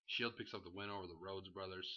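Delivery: ordinary talk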